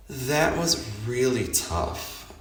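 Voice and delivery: ordinary talk, nothing marked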